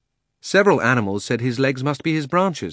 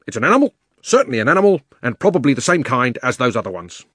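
gruff bulldog voice; 3 semitones higher, 6 dB louder, faster than usual. Acted. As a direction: rattle it off like an eager bulldog. Bulldog speaks in story.

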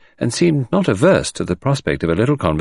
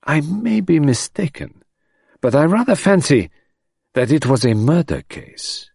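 French detective; 8 semitones higher than usual. A narrator's reading.

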